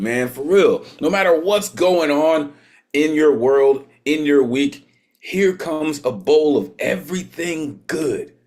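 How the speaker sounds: high, loud MC hype-up; hype announcer. Real, not acted. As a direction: pump up the crowd for the next act. Host hypes up next performer.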